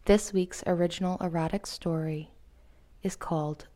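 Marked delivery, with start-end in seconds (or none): none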